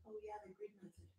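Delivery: fast, breathy whispered aside. Real, not acted. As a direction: mutter it under your breath, quickly. Aside to herself.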